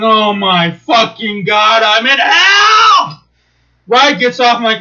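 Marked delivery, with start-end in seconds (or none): 0.0-3.2 slow, building to a high, loud wail; despairing yell. Retold or imitated. Stuck on the ride with screaming kids, wailing. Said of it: "And I'm going".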